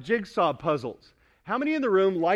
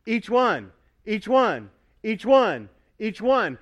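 pointing at each one; 3 semitones higher, 6 dB louder, slower than usual. Pretend. Pastor pointing down the pew at each listener, loud. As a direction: call out each person in the row. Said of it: "like going down the row here going".

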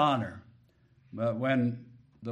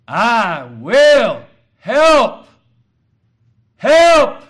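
I will help dragged slowly, help shouted much higher and louder; preacher's roaring emphasis on help; stress on help; 12 semitones higher, 18 dB louder, much slower than usual. Shown as a demonstration. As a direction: lean hard on help, then shout it again. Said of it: "he emphasized each word".

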